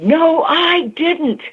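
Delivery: high, slow, drawn-out denial; looming denial. Retold or imitated. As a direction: act out his indignant refusal, stretching each word. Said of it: "he loomed at me and said".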